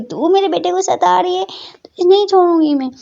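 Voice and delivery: high playful scolding mother; mother mock threat. A narrator's reading. Mother teasing daughter for tormenting son, mock threat. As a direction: threaten her jokingly.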